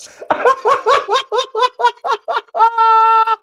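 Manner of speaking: high shrieking laugh; hard laughter. Real, not acted. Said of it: "I didn't mean to laugh that hard".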